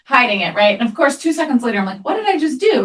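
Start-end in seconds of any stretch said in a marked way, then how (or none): 2.0-2.8 high sudden self-reproach; mock horrified gasp of a question; dismayed realization. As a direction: catch yourself mid-mistake, aghast. Mother realizing her mistake.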